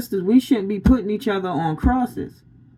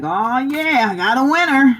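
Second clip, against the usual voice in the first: gleeful devil; 5 semitones higher, 3 dB louder than usual. Retold or imitated. Voices the delighted devil.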